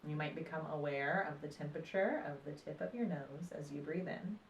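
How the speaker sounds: calm, unhurried meditation voice with long pauses; guided meditation. Acted. Meditation teacher guiding listeners through breath and body awareness, calm guided meditation.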